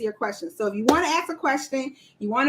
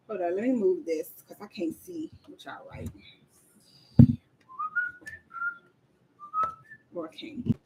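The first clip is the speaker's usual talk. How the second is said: whisper aside; 5 dB quieter than usual. Real, not acted. Muttering aside while fixing camera.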